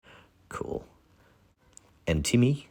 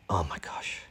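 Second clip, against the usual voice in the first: awed whisper; 7 dB quieter than usual. Real, not acted. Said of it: "awe at the art".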